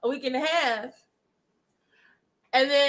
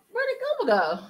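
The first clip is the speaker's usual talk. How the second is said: puzzled quote; 10 semitones higher than usual. Retold or imitated. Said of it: "And we're like".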